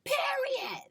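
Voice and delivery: very high squealed sign-off; shrill high squeal. Real, not acted. Podcaster capping the episode sign-off, in a high squeal. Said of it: "don't say it like that no more".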